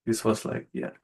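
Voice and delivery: normal talking voice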